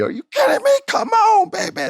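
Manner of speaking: high, loud hype call like a commentator; excited cheer. Retold or imitated. Reliving wrestling call excitedly.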